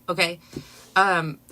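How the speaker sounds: normal talking voice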